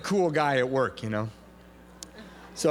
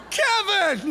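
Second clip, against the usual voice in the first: high, loud cry of a name; calling out; 5 dB louder than usual. Retold or imitated. Greeter spots a man outside the meeting, excited high holler. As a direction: shout it across to someone walking by.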